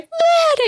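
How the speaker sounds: very high hammed-up crying wail; mock bawling wail. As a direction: burst into pretend bawling. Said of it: "bawling, crying".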